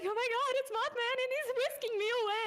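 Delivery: high, fast, breathless swoon; swooning gush. Pretend. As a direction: gush like a smitten fan. Imagined swooning over Mothman.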